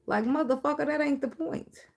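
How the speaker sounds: fed-up squeal at the lyric; exasperated outburst. Real, not acted. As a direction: throw your hands up at the nonsense.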